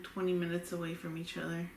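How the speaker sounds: usual speaking voice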